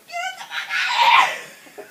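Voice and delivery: drawn-out, high, loud scream. Retold or imitated. Woman in labor yelling at baby, screaming. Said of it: "the person who was screaming".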